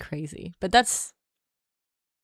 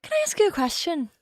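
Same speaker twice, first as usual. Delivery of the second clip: high little-niece voice with an Irish lilt; mock child voice, Irish accent; imitating little Irish niece; 9 semitones higher than usual. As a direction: pitch it up like a small Irish niece asking. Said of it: "my little niece".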